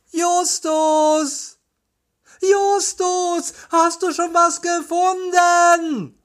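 very high, sustained call. A narrator's reading. Peter calling Justus after ten steps, high calling. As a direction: holler for a friend on one high note. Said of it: "alle zehn Schritte zu rufen".

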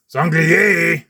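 high, loud call for the servant; loud bark. Acted. King shouts for his servant, loud. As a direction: bark the name across the castle. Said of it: "raunzt er laut".

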